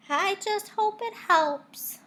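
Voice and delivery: put-on high, slow girl voice; Margaret's voice. A narrator's reading. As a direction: voice a hopeful little girl. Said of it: "Margaret said".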